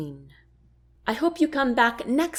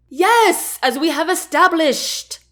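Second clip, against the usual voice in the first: high, loud exclamation; exclaimed; 8 semitones higher, 4 dB louder than usual. Acted. Chancellor to queen, exclaiming high and loud. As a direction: burst out with it, triumphant. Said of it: "he exclaimed".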